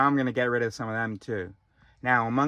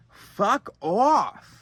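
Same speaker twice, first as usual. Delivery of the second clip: higher, louder fuck off; louder, angrier dismissal; 7 semitones higher, 6 dB louder than usual. Real, not acted. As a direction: throw it out loud and hard.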